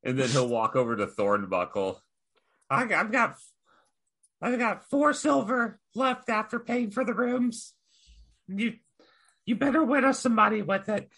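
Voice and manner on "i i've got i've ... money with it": high, hesitant goblin voice; high goblin voice addressing the barmaid; timid goblin. Acted. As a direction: creep up and ask nervously, pitched high. Goblin speaks to Thornbuckle.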